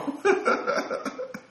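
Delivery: high, airy chuckling; real laugh. Real, not acted. Speaker amused that nobody teases him, laughing. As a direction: chuckle after your own punchline.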